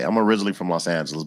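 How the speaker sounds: ordinary talk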